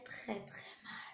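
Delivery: hushed, slow whisper. Real, not acted. Warning, voice drops to whisper.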